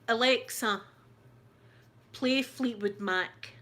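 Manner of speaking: loud slow voice command. Real, not acted. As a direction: speak clearly to a smart speaker. Voice command to device.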